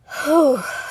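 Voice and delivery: long deep calming sigh; deep breath out. Real, not acted. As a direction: exhale slowly to reset. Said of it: "let me take a deep breath real quick".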